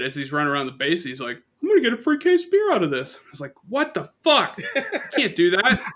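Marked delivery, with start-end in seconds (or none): 1.6-3.2 put-on high, fast excited kid voice; a kid squealing about his prize; gleeful kid. Kid cheering to the umpire, high gleeful squeal. 3.7-5.8 shocked disbelief. Retold or imitated. Retelling his shock at the umpire's promise, high laughing outburst.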